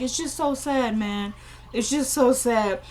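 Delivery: floating high man. Retold or imitated. Ben high, pitying the woman, floating. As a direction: imitate a stoned man feeling sorry. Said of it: "he's floating".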